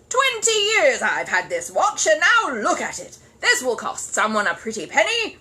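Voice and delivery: loud, high, furious rant; raging. Acted. Curry to the party, loud furious rant. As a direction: rant over the ruined watch. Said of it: "beside himself with rage".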